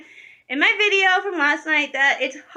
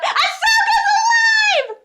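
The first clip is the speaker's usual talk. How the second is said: a sudden high, loud yell of joy; overjoyed scream of news; excited shout; 5 dB louder than usual. Real, not acted. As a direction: blurt it out at the top of your lungs. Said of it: "that was louder than I thought it would be".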